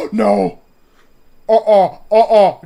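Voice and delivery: loud frightened refusals; anguished denial. Real, not acted. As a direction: yell no in real panic. Scared player refusing to look.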